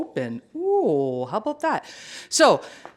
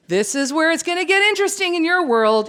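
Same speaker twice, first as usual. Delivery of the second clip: excited; 10 semitones higher, 4 dB louder than usual. Real, not acted. Hyping the coming features.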